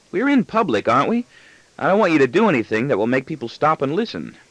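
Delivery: put-on high child's voice scolding a parent; admonishing little boy. Son warning his father about being in public, high admonishing voice. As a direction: correct Dad like a bossy small son. Boy's voice in narrated story.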